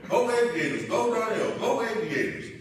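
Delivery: loud retold cheering from the stands; cheering shout. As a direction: yell his name like a proud dad in the crowd. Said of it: "we're screaming his name".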